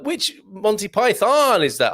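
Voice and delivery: drawn-out American vowel on Python; American accent. Acted. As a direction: ask it in a light mock-American accent. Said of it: "I'll do that in my American accent".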